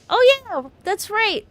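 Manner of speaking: high, loud sudden realization; excited shout. Real, not acted. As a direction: shout it out as it dawns on you. Player suddenly remembers, shouting.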